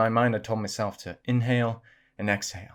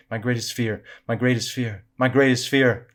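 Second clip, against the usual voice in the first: louder and louder; 2 semitones higher, 7 dB louder than usual. A narrator's reading. Echo repeating back to Samantha in the cavern, louder and louder. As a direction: echo building from normal to very loud. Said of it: "louder and louder".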